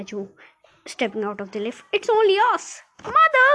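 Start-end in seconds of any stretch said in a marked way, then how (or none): 1.9-2.8 calling out. A narrator's reading. Calling out to frightened relatives. 3.0-3.5 high, loud call; excited cry. A narrator's reading. Charlie rushes into mother's arms, crying out. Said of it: "cried Charlie".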